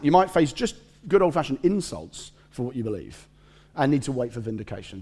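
ordinary talk, nothing marked

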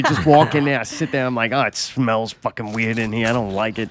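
low, soft grumble, lightly acted; quietly grumbling to yourself; retold grumbling. Retells own grumbling on sitting down.

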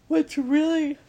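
a high, loud yawn swallowing the words. Real, not acted. Coach breaking into a yawn while addressing viewers. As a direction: let a yawn take over the words. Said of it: "oh, another release".

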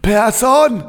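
high crowd shout of the surname. Pretend. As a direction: yell the surname like a fan. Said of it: "im Stadionsprechermanier".

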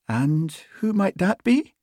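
high, slow, lilting island question; soft Western Isles lilt. A narrator's reading. Constable noticing sergeant's cat, soft Western Isles lilt. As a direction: ask curiously in a Hebridean lilt. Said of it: "in a soft voice delicately laced with an endearing lilt".

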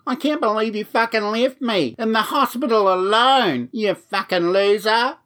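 high, tearful mock yelling; yelling and crying. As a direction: imitate a boyfriend's wailing accusation from the street. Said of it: "yelling and crying".

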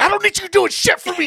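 a fast, high, loud snap; retold grouchy outburst. Grouchy patient snapping at the friend over the phone cord, fast high yell.

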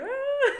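high, loud mock wail; imitated crying. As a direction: squeal out a hammed-up sob. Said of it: "just crying".

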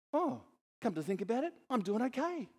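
high, brightened second thought; mock realisation. Friend in the story suddenly cheering up, high. Acted reply, reconsidering.

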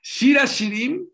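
Shira Shirim más alto y fuerte, lento; anúncialo con fuerza; título proclamado. Acted. (proclamando Shira Shirim despacio)

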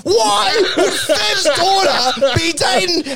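high, slow, disbelieving whine; mock outraged question. Duck demanding answers from Rance, high and slow. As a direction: stretch it out in fake outrage.

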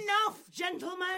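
loud, high shout for order; commanding shout. Acted. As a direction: cut the quarrel dead. Silencing an arguing room.

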